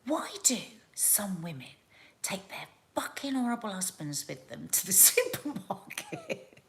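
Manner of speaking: conspiratorial whisper of her friend's rant; whispered quote. Retold or imitated. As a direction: hush it like a juicy secret. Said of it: "and she said".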